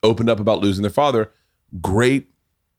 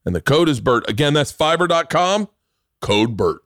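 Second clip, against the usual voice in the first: upbeat ad read with lifted key lines; podcast ad read; 5 semitones higher than usual. Real, not acted. Host pitching a freelancer service to listeners, ad read. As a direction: sell it like a host-read sponsor spot.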